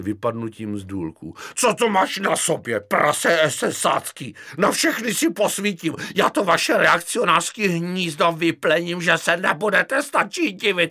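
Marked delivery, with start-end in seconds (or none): 1.5-10.9 high, loud shouted tirade in a fit of fury; raging general. Acted. General in a mad fit threatening Kefalín, enraged shouting. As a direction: yell abuse as if losing your mind. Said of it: "Jeho tvář byla stažena vztekem".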